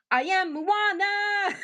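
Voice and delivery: high, slow, proud declaration; Moana line. Pretend. Acts Moana's line for the guessing game, high and slow. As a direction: announce yourself like Moana. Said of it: "who is she talking to?".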